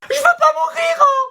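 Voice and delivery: very high, crying scream; hammed-up terrified screech; panicked wail. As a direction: burst into a shrieked, tearful plea. Fear bursts into a scream.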